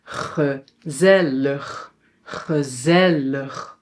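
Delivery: GEZELLIG very slowly, first and last vowel as uh; slow, low-key GEZELLIG, syllable by syllable; schwa at both ends. Shown as a demonstration. Teacher shows the end is also a schwa, slow syllables. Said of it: "also pronounced as a schwa".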